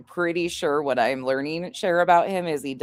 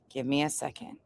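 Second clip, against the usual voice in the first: usual speaking voice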